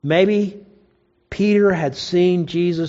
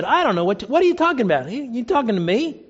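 higher-pitched, playing innocent; mock incredulous denial; 4 semitones higher, faster than usual. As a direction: act out a puzzled "who, me?". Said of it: "Peter denied it and said".

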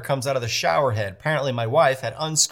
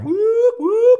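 very high, loud siren whoop; imitated alarm whoop; 7 dB louder than usual. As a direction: mimic a shrill alarm siren. Imitating the Amazon alarm again.

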